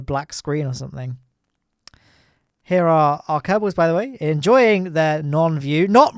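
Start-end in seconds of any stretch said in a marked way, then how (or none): none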